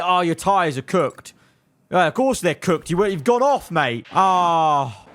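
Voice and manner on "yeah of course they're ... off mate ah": ordinary delivery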